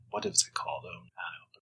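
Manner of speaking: muttered under the breath to himself; whispered aside. Real, not acted. Interviewer whispering to himself while trying to recall a name. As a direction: drop to a whisper while searching memory.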